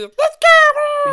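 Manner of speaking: high squeaky cheer, very loud; excited mouse voice. Pretend. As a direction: squeal it like a tiny critter. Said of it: "He saw the opportunity".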